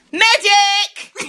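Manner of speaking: loud high call for a medic; mock shout. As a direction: shout for help across the set. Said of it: "she goes".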